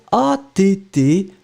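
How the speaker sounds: slow letter-by-letter spelling. Shown as a demonstration. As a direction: spell each letter clearly. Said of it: "So it's attend".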